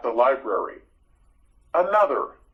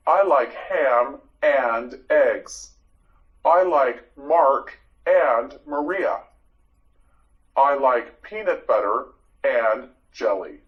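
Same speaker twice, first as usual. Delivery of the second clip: slow example sentences. Shown as a demonstration. Teacher models example sentences.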